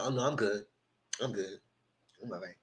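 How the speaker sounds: quiet, low brush-off, fading away; imitated sulky withdrawal. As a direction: mimic someone pouting and shutting down. Acts out Jeremiah pouting, withdrawn.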